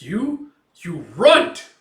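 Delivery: loud, high furious snarl; enraged outburst. Acted. Gambino cut by little Guts, enraged loud outburst. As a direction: explode at the runt.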